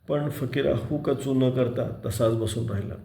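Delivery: ordinary delivery, nothing marked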